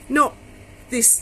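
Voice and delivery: ordinary delivery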